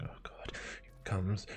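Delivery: whispered mock dread; freaking out. Teen with trembling fist bracing himself, fearful whisper. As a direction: whisper it to yourself, bracing for a blow. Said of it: "I'm, like, freaking".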